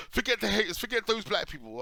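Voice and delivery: "Forget the haters" pitched very high and loud; scornful high mimicry of "Forget the haters"; mocking headline. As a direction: ham up the headline in a sneering high voice. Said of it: "you can now come out and go".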